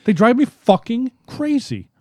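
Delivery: fed-up outburst, pitched high; exasperated. Real, not acted. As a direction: hammer home how fed up you are. Repeating the complaint harder.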